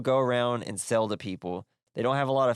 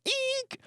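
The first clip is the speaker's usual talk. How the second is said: mock brake squeal.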